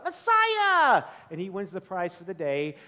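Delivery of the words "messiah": high, loud eager shout; shouted answer. Retold or imitated. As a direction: blurt it out like the winning answer. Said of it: "shouts out".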